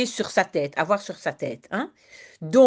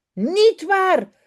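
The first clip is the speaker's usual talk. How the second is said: roaring; 11 semitones higher, 5 dB louder than usual. Acted. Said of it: "brult Jip".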